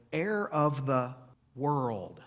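"Heir of the world" said slowly, leaning hard on "Heir"; italicized emphasis. Shown as a demonstration. Pastor highlighting a Bible phrase, slow stressed "Heir". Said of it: "I tried to italicize that in my accent".

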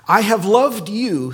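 loud, slow, high declaration; God declaring love. Acted. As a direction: proclaim it with force like God. God's voice in scripture.

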